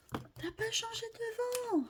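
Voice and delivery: lightly voiced teasing viewer, high; a hint of a viewer's reproach; imitating viewers. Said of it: "Je vous entendais". (voix aiguë moqueuse d'un spectateur)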